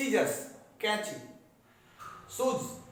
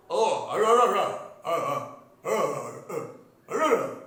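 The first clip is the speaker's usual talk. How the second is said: slow, slurred, lipless अरे sounds, louder; speech without lower lip; 6 dB louder, slower than usual. Shown as a demonstration. Teacher shows students a mute man calling अरे. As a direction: show how you talk with no lower lip. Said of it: "देखिए, मैं गूंगा बन गया".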